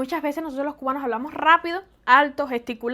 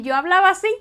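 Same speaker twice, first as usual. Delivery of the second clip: louder, higher amazed reaction; Ricky; 6 semitones higher, 10 dB louder than usual. Retold or imitated. Ricky reacting to his old video, amazed. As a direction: exclaim it in disbelief, raised voice. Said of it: "Dice Ricky".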